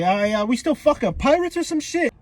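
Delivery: mock indignant query; doubtful protest. Buggy objects to utopia plan.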